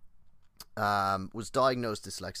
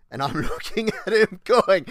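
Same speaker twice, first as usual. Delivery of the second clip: laughing; 9 semitones higher, 12 dB louder than usual. Real, not acted. Laughing while retelling.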